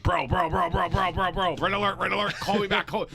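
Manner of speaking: loud, high, urgent machine-gun repetition; high-pitched mock-panicked yelling; frantic alarm. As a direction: sound a frantic alert to your buddy. Frantically repeated texts to friend.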